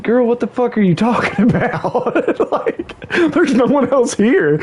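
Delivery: high-pitched, laughing retold disbelief. Family to tripping drunk sister, laughing high-pitched disbelief. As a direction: crack up while asking in disbelief.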